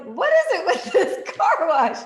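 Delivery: high, loud, slow laughing question. Retold or imitated. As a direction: laugh through the words, amazed. Laughing at the compliments.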